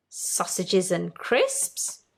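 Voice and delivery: ordinary delivery, nothing marked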